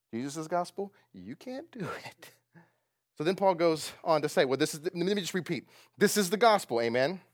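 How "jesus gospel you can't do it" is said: softer, chuckling through it; laughing aside. Shown as a demonstration. Preacher contrasting Jesus' gospel, soft laughing. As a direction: let a laugh break in. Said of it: "Jesus' gospel".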